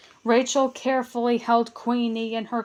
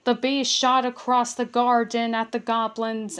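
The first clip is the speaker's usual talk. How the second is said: usual speaking voice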